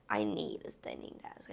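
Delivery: fısıltıyla kendi düşüncesini aktarıyor; whisper. Retold or imitated. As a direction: sesi iyice kısıp fısılda. Quoting her own past thought.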